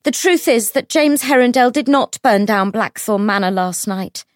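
high, ringing public announcement; very loud declaration. A narrator's reading. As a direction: call it out for the whole room to hear. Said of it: "in a voice so loud".

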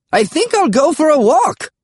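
put-on very high, chirpy old grandpa; high, carefree cheer; cheerful. A narrator's reading. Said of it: "Grandpa Smedry said cheerfully".

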